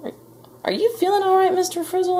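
very high, hesitant questioning voice; doubtful policeman. A narrator's reading. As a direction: ask carefully, as if humouring him. Police doubting an agitated man.

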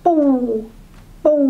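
ปู่ pushed straight down low. Shown as a demonstration. Teacher naming the second tone, low-tone ปู่. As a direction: drop ปู่ at once with no rise first. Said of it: "low tone".

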